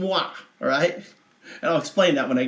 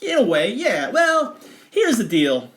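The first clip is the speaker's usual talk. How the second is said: laughing; 6 semitones higher, 4 dB louder, slower than usual. Real, not acted. Laughing before telling the story.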